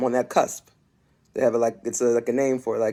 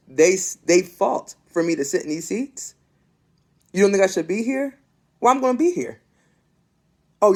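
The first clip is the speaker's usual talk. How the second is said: rising, raised, impassioned sermon voice; fiery preaching; 5 semitones higher than usual. Acted. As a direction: build it like a preacher at full force.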